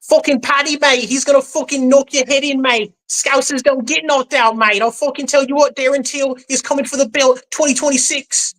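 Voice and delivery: high, hammed-up Scouse fan; Scouse accent. Scouse impression for the host, hammed up. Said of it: "I want British".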